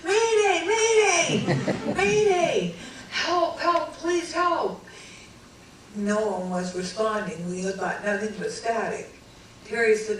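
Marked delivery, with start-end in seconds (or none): 0.0-4.8 high, loud laughing-crying mayday; frantic mayday. Retold or imitated. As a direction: shriek into the radio half laughing. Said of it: "I says".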